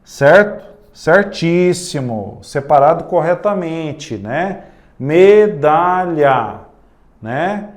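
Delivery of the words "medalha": Medalha slow, the da leaned on; stress in medalha. Shown as a demonstration. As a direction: hit the da in Medalha. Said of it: "Aonde que é mais forte aqui". (Medalha com ênfase no da)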